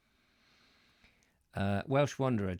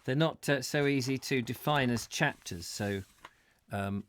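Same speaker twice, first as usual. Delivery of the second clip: ordinary talk, nothing marked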